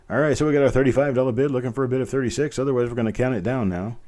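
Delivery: brisk auction patter, counting it down; fast auction call to a final close; auctioneer countdown. Acted. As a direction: press the room for a last bid, fast.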